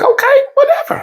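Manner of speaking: high mocking wife voice, brushing it off; high-pitched dismissive imitation of the wife. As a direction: squeak out the wife's bored reply. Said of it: "She's like".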